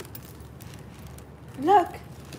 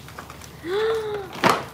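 delighted gasp; 4 semitones higher than usual. Real, not acted. Delighted gasp at baby's clothes.

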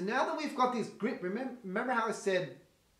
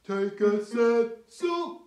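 low larynx voice; 3 semitones higher, 6 dB louder, much slower than usual. Shown as a demonstration. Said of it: "introduce the air by doing the Cookie Monster".

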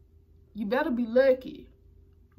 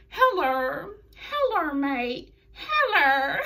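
higher, laughing Australian "Hello, mate"; hammed-up Australian accent; 3 semitones higher, slower than usual. Host retrying Ruby's mocking of Peyton, exaggerated Australian accent. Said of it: "I couldn't even say it like them".

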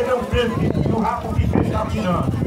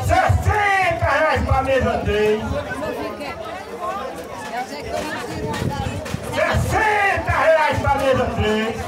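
slow, higher shouted bid calls; 3 semitones higher, slower than usual. Real, not acted. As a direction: bellow the bid slowly to the far table. Auctioneer shouting bids across hall.

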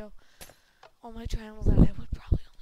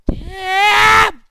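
high, loud outburst; sudden loud damn; 7 semitones higher, 10 dB louder than usual. Real, not acted. Gamer cursing a surprise fall. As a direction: blurt it out.

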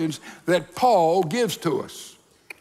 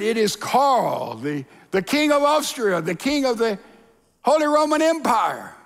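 proud proclamation, rising higher; self-important emperor; 4 semitones higher than usual. Acted. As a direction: announce yourself grandly, title by title. Emperor proclaims himself once more.

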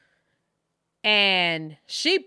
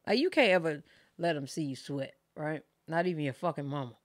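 normal talking voice

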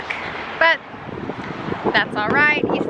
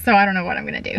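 ordinary delivery

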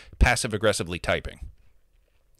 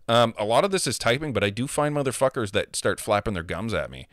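ordinary talk

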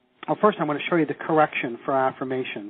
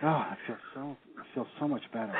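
very soft, relieved exhale; acted relief, hushed and sinking; sighing relief; 3 semitones lower, 14 dB quieter than usual. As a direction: let the words out on a sigh of relief. Acts out relief at softer sentence.

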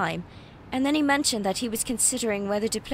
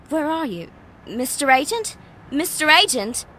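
calling louder each time into the empty street; 4 semitones higher, 6 dB louder than usual. Acted. Searching for the agent who vanished, calling out. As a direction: call after him, a little unsure.

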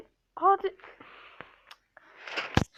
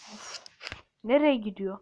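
ordinary talk

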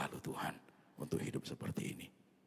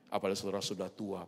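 ordinary talk

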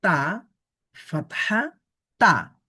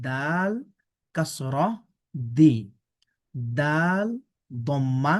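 ordinary delivery

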